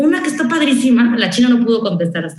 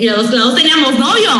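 usual speaking voice

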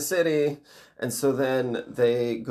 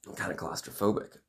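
ordinary talk

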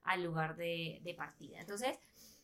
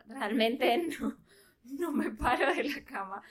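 laughing; 3 semitones higher, 5 dB louder than usual. Real, not acted. Confessing while laughing.

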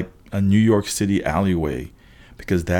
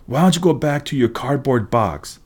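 higher-pitched bully's taunt; mild acted yell; 5 semitones higher than usual. A narrator's reading. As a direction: call it out as a jeer, keeping the volume in check. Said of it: "Sloan yelled".

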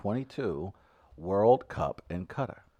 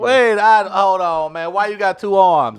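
high, loud comic challenge; mock outrage; 8 semitones higher, 13 dB louder, slower than usual. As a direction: call out a cheat in mock disbelief. Acted challenge to a doping Paralympian.

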